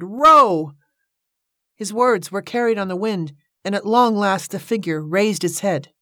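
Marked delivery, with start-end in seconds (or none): 0.0-0.7 loud, drawn-out warning shout; urgent carrying yell. A narrator's reading. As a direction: call it out hard through cupped hands. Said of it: "he shouted".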